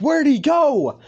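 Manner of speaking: high, loud bewildered cry; baffled shout. Acted. Everybody looking around for the vanished villain, loud bafflement. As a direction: call it out in mock confusion.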